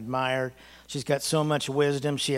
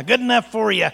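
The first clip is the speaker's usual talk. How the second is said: higher, louder smug told-you-so mom; mocking mother; 7 semitones higher, 7 dB louder than usual. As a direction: scold with glee, I told you so. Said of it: "And here's my mother".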